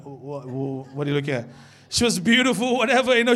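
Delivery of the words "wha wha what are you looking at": low, soft mock-tough mumble; imitated young man. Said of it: "as a young man".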